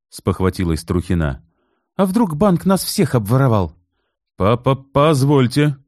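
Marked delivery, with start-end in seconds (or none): 2.0-3.7 alarmed. A narrator's reading. Client alarmed. 4.4-5.8 high, slow stammering cry; crowing stammer. A narrator's reading. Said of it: "закукарекал тучный".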